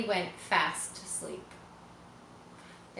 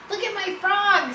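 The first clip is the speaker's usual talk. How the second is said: put-on much higher, louder boyish shout; excited boy; 12 semitones higher, 7 dB louder than usual. Avery showing Mrs. Zuckerman his frog, loud and excited. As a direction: show off the frog proudly. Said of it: "said Avery".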